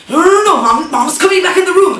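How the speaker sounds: frantic high warning; panicked sister. Retold or imitated. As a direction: sister hissing that Mom's coming back. Sister panicking, mom returning.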